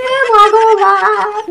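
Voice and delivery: drawn-out, very slow oh God; long despairing groan. Acted. Elder sister despairing over her foolish sister, drawn-out groan. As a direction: stretch it out in despair.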